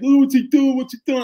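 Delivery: high, loud sneering mock of the online critics. Firefighter mocking armchair critics online, loud high sneer. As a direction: parrot the critics in a whiny high jeer. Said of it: "everybody's like".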